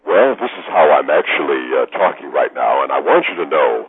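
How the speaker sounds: low, polished announcer delivery. Shown as a demonstration. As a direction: drop well down into your announcer voice. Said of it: "he started to hear this voice".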